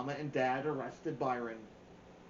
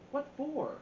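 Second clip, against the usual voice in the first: suspicious question; 12 semitones higher than usual. Acted. Byron answers his parents suspiciously.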